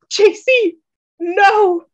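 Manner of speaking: high, loud shout of horror; loud, high cry of panic; anguished cry. Acted. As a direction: scream his name in shock. Lover shot in front of him.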